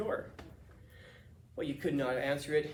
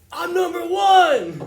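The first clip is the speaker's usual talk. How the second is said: much higher, very loud, drawn-out yell of victory; triumphant shout; 16 dB louder, much slower than usual. Retold or imitated. Customer shouting to the whole restaurant, triumphant drawn-out yell. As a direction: belt it out slowly like a proud winner. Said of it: "turns to the restaurant and goes".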